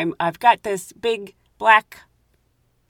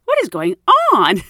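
baffled thought; 7 semitones higher, 6 dB louder than usual. Retold or imitated. Confused inner thought, exclaimed.

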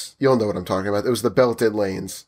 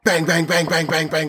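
higher, rhythmic bang bang bang mimicking fists; imitated door banging; 5 semitones higher than usual. Telling coworkers how the man pounded the closed door, mock banging. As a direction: knock out each bang like pounding.

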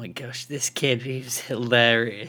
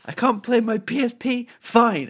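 high-pitched mock protest; high voice, landing louder on "Fine"; imitating a defiant kid; 10 semitones higher, 3 dB louder than usual. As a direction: act out a kid's huffy comeback, high and punchy. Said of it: "while talking particularly loud or anything".

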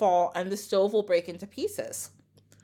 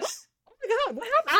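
shocked reading; 12 semitones higher than usual. Retold or imitated. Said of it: "I was reading and I was like".

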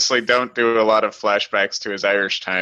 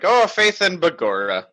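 hammed-up Irish brogue, high and drawn out; mock Irish accent; 7 semitones higher, slower than usual. Podcaster mocking an actor's bad Irish accent, hammed-up and slow. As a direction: do a cheesy stage Irish accent. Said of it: "never really fully committed to his Irish accent".

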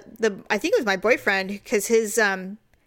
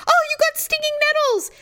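very high put-on voice; high-pitched imitation of onlookers; mocking friends' reaction. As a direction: call it out in a high squeal. Retold friends' reaction to the sting.

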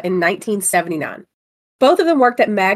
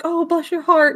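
doting sympathy; 9 semitones higher than usual. Retold or imitated. Quoting the customer.

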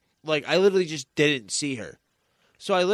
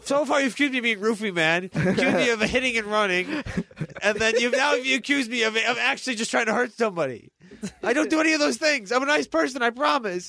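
higher, insistent self-defense; indignant protest; 7 semitones higher than usual. Real, not acted. Friend protesting he is a nice person, indignant and high. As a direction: plead your innocence, worked up.

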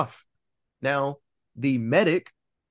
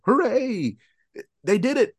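a higher ironic hooray; mock cheer; 7 semitones higher than usual. Cheering the commandos' kill, ironic higher hooray. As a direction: throw out a sarcastic little cheer.

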